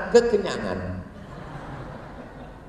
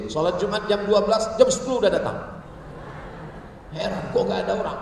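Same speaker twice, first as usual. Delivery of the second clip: ordinary talk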